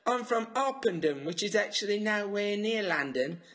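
high-pitched, put-on Mockney; exaggerated London Cockney, pitched well above normal; mock Cockney accent. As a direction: ham it up as a squawky fake Cockney. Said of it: "a Cockney accent".

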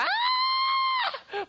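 very high cartoon yelp of pain; mock yelp. Boy yelping as his dad swings to spank him. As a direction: squeal as if about to be hit.

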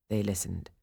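plain reading voice

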